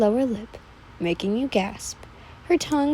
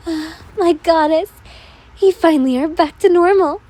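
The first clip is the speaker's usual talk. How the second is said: higher, louder, fast relieved cry; eager servant; 6 semitones higher, 7 dB louder than usual. Acted. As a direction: gush with relief at your goddess. Servant greets awakened goddess.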